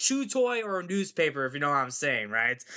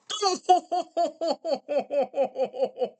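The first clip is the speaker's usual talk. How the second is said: long, high mock guffaw; heckler cackle; 12 semitones higher than usual. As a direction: cackle at your own joke like a theater heckler. Hecklers laugh at own joke.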